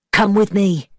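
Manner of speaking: plain reading voice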